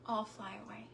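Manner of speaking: breathy whispered line from a song. Retold or imitated. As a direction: murmur the song title under your breath. Quotes song stuck in head.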